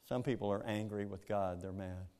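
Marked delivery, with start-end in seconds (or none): none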